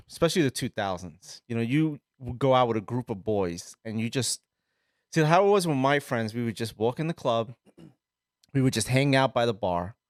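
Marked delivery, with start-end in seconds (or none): none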